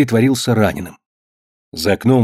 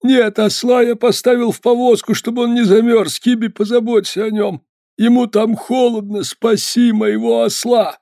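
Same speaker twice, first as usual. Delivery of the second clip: high, strained voice of a hurt man; injured peddler; 12 semitones higher than usual. Acted. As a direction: voice a frozen, injured peddler in pain. Delirious peddler.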